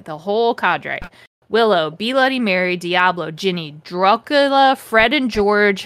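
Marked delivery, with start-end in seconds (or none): none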